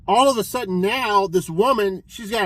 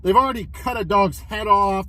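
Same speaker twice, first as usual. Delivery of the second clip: usual speaking voice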